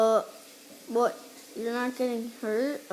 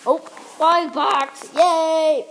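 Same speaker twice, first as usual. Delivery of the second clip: excited; 5 semitones higher, 8 dB louder, slower than usual. Real, not acted. Finds a blind box in game.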